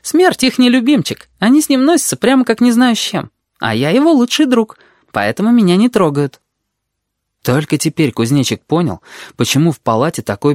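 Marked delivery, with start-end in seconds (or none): 0.0-6.4 put-on high-pitched, lively girl's chatter; confident red-haired girl. A narrator's reading. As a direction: chatter on high and sure of yourself. Voices the red-haired girl, boasting.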